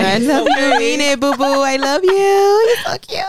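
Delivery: high slow sing-song love; sing-song loving. Real, not acted. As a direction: coo it up high. Sweet message to partner.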